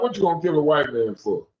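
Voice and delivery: ordinary talk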